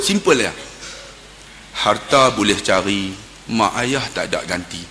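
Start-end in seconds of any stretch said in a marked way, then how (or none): 1.8-4.8 hushed, lowered voice, unhurried; gentle counsel. Retold or imitated. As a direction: console him with quiet advice. Counselling the weeping young man.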